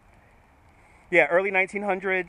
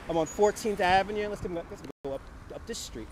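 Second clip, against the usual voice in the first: ordinary delivery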